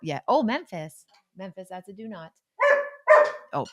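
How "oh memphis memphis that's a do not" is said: real aside correcting a dog; gentle scolding. Real, not acted.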